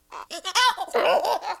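squeaky monkey cackle; high creature chitter. Acted. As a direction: chatter like a hyper little monkey. Laughs as Spider Monkey.

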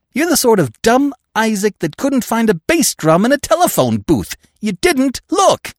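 high scornful jeer; mock his stupidity; scornful insult. Peavy insults the bungler.

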